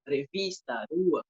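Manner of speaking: revista and rua said with a tapped R, not the throaty R; a light tapped R on revista and rua; weak R demo. Shown as a demonstration. A teacher demonstrates the regional weak R. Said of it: "o R fraco".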